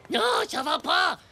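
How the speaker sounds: non crié haut, excédé; hurle-le, à bout. Acted. Titeuf excédé. (Titeuf crie à Thérèse qu'il n'en peut plus)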